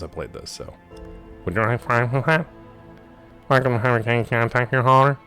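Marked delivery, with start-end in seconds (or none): 1.4-2.5 tinny drive-through order voice; crackly fast-food intercom; drive-through speaker voice. Pretend. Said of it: "Bad drive-through speaker?". 3.5-5.2 tinny drive-through order voice; crackly fast-food intercom; drive-through speaker voice. Pretend. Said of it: "Bad drive-through speaker?".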